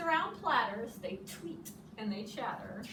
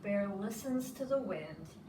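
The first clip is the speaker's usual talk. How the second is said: ordinary delivery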